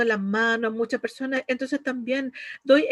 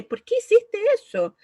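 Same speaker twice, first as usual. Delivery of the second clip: much higher and louder, demanding an answer; accusing demand; 11 semitones higher, 5 dB louder than usual. Shown as a demonstration. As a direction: fire the question like an accusation. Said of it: "de cualquier forma que uno diga". (pregunta acusadora, mucho más aguda y fuerte)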